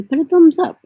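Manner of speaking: high, loud call to viewers. Real, not acted. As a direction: call out to the audience for a thumbs up. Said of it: "asks viewers".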